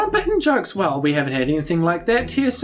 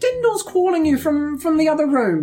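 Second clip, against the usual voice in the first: Binky's sweet voice; 6 semitones higher than usual. Acted. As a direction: sweetly lure Spoonie away. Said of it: "and she says".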